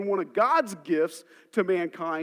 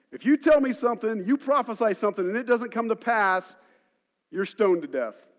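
loud, raised, emphatic pulpit voice; forceful preaching; 4 semitones higher, 7 dB louder than usual. Real, not acted. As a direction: drive the warning home to the congregation.

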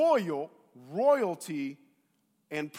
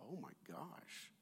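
very soft, slow aside; the kid's quiet uh-oh reaction; hushed dismay; 3 semitones lower, 21 dB quieter, slower than usual. Retold or imitated. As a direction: say it under your breath, drawn out. Kid's dread at mom's yell.

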